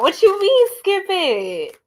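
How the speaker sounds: high, loud disbelieving question; What you mean, skip it? thrown back in shock; loud, high incredulous retort; surprised. Real, not acted. Reacting to a viewer's comment.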